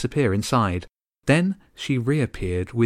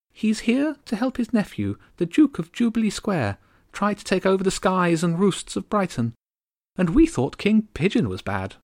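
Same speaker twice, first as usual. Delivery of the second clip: tiny haughty countess, aggrieved; high regal bird voice; 10 semitones higher than usual. Acted. Countess complaining about the gulls, high and bitter. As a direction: complain like an offended little queen.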